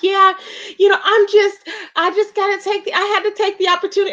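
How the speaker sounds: high-pitched imitation of tearful excuses; mock boohoo crying. Retelling her excuse for taking the opportunity, mock crying. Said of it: "Like I said, she was boohoo crying".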